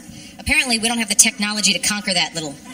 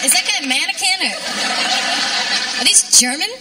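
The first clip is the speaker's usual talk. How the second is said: mock baffled TV viewer, a bit higher; 5 semitones higher than usual. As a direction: play a confused viewer of a candy ad. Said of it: "my impression of someone watching the latest Mentos".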